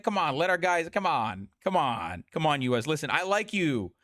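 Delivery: low-pitched imitated wheedling, repeated; mock cajoling. Politician sweet-talking the US, mock cajoling. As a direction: coax it low, over and over. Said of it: "He's literally just being like".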